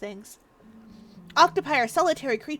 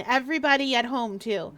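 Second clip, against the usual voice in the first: usual speaking voice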